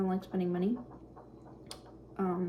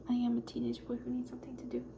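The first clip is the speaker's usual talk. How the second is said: hushed and fast; 3 semitones higher, 6 dB quieter, faster than usual. Real, not acted. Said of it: "I can't bring myself to talk very loudly".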